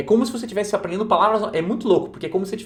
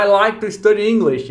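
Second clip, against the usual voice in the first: a louder English line, read like the course recording; English audio narrator; 4 semitones higher, 7 dB louder than usual. Retold or imitated. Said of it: "Você escuta o narrador do texto falar".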